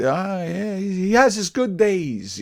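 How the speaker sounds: a hint of a patronizing caregiver, drawn out; nursing home attendant.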